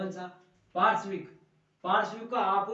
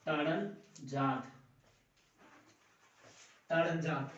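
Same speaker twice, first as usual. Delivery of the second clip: slow dictation; 3 semitones lower, slower than usual. Shown as a demonstration. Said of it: "कौन सा दोस्तों?".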